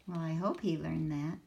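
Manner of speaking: usual speaking voice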